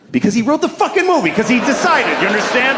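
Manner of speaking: high-pitched, indignant outburst; exasperated rant. Acted. Exasperated rant about the film.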